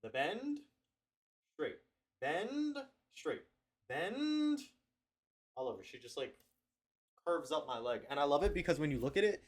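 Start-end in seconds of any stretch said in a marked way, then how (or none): 0.0-4.6 rising on bend. Shown as a demonstration. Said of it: "with my legs straight".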